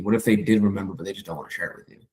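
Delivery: ordinary talk, nothing marked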